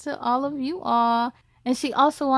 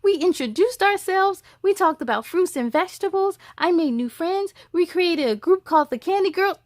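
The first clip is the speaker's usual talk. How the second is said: put-on rushing, eager higher child voice; excited child; 6 semitones higher, 5 dB louder than usual. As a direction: tumble it all out to mom.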